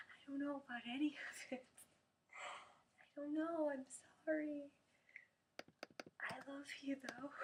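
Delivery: high, overwhelmed mock apology; high-pitched helplessness. Vlogger giving up on a question, high mock-helpless apology.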